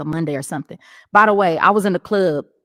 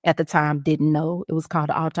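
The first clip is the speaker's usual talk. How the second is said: usual speaking voice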